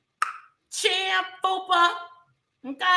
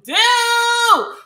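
drawn-out exclaim; 10 semitones higher, 9 dB louder than usual. Real, not acted. Drawn-out loud ending.

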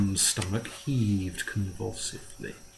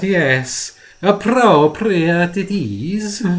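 slow, higher, louder fawning voice with a giggle; oily little man teasing his favourite client, relishing it; gloating greasy dealer; 4 semitones higher, 7 dB louder, slower than usual. Acted. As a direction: savour every word, gloating over the secret. Marco's gloating voice held.

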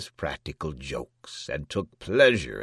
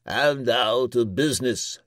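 raised, louder official voice; prime minister; 6 semitones higher, 6 dB louder than usual. A narrator's reading. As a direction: call the company to business.

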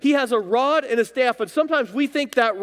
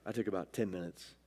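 usual speaking voice

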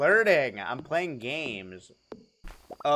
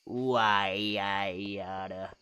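slow low Why I oughta; old-timey tough guy; 6 semitones lower, 3 dB quieter, much slower than usual. Pretend. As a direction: drag it out like a gangster. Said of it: "sounds so natural".

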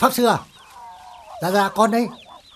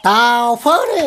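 slow, drawn-out and louder; brash sign-off; 5 semitones higher, 5 dB louder, much slower than usual. Acted. Caller hanging up with a cocky drawl. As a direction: swagger out the last words.

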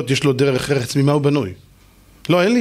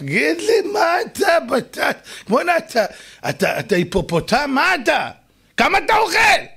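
mock shouting; 9 semitones higher than usual. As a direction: shout it at him like you can't believe how much he eats. Mocking a glutton, shouting. (צעקה מתגרה)

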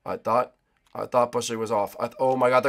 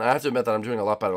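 ordinary talk

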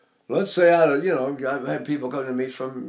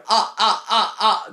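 short, higher "ah"s with hard onsets; demonstrated "ah" attacks; 5 semitones higher, 3 dB louder, slower than usual. Shown as a demonstration. Singing teacher tests where the breath stops, crisp staccato "ah"s. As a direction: fire off four separate "ah"s. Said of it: "And I'm going".

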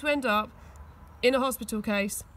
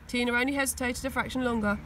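ordinary delivery, nothing marked

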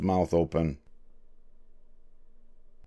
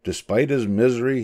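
ordinary delivery, nothing marked

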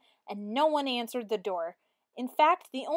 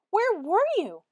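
much higher, loud, joyful cry; cried out; 10 semitones higher, 6 dB louder than usual. A narrator's reading. Children welcoming their teacher back, joyful cry. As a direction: shout it out with joy. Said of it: "cried all the kids".

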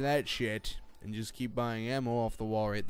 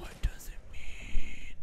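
slow, stretched-out whisper; hushed hammed-up wonder; mock awed whisper; slower than usual. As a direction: whisper it as if overwhelmed. Parody of amazed viral video.

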